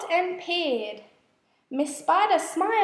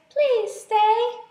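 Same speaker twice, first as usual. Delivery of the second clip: higher, louder pleading spider voice; begging; 6 semitones higher, 4 dB louder than usual. A narrator's reading. Miss Spider begging the beetles to stay, higher pleading voice. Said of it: "Miss Spider begged".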